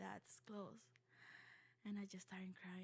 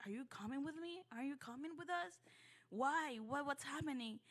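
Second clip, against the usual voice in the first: put-on higher, urgent child's questions; retold anxious questioning; 6 semitones higher, 4 dB louder than usual. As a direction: replay a worried kid pressing for answers. Child questioning dad at airport.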